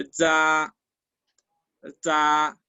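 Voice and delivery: "Za" with a ds-like onset, no air pushed out; tongue tip on the teeth for "Za"; unaspirated Z. Shown as a demonstration. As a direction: hold "Za" after a buzzy, puffless z. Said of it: "no expulsamos aire al mencionar esta letra".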